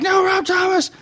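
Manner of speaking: high, pained cry of protest; anguished cry. Acted. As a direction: cry out as if breaking. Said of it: "And she's like".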